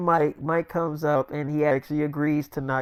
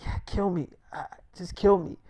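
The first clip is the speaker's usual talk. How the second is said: soft, halting plea; feeble, worn-out voice; imitated weakness; 7 dB quieter than usual. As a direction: beg quietly with little strength left. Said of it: "'cause he was still kinda weak".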